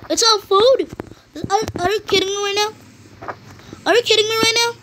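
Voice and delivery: high outraged disbelief; raging gamer. Real, not acted. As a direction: shriek in gamer frustration. Disbelief at loot.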